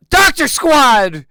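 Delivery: loud, slow, drawn-out announcement; big heroic entrance yell; kicking-the-door shout. Acted. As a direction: burst in and proclaim it. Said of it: "kick the door open and say".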